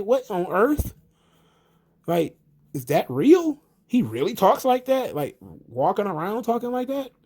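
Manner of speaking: high-pitched incredulous questions; replayed astonished reaction; retold stupefaction. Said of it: "I was stupefied".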